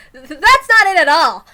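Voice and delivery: high, loud, stammering denial; embarrassed man waving off teasing, flustered; flustered Tomitake. Acted. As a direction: protest too much, flustered.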